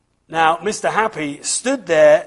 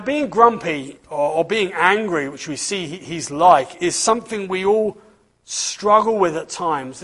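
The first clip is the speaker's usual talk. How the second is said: ordinary delivery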